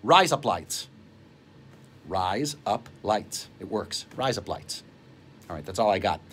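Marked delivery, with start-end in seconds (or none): 0.0-0.9 razor blades said as "Rise up lights" in Australian; Australian accent, quick and casual. Shown as a demonstration. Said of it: "how to say razor blades in Australian". 2.1-3.5 "Rise up lights" drawn out, lower and softer in Australian; Australian accent, spelled out slowly. Shown as a demonstration. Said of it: "how to say razor blades in Australian". 4.1-4.8 Australian accent. Shown as a demonstration. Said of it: "how to say razor blades in Australian".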